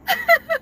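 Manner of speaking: short, very high laugh; a quick high giggle; high little laugh. Real, not acted. Laughs while advising.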